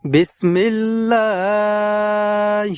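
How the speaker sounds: slow, drawn-out tajweed chant; Quran recitation. Shown as a demonstration. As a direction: recite slowly with long held vowels. Recites taawudh and basmala. (ধীরে টেনে কুরআন তিলাওয়াত)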